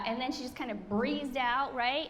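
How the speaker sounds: normal talking voice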